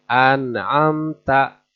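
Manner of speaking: An'amta slowly, the n distinct before the ain; slow careful recitation; clear nun. Shown as a demonstration. Teacher recites an example slowly. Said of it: "harus jelas".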